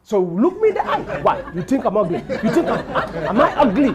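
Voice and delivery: loud mock indignation, laughing through it; indignant woman. Woman in elevator demands if she's ugly, loud mock outrage. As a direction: demand it as if deeply insulted.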